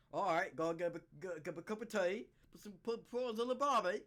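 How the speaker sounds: mock laid-back Australian voice; Australian accent. Comedian imagining Melbourne flood victims, mock Australian accent. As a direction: play an unbothered Aussie in a flood. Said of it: "I can do Australian".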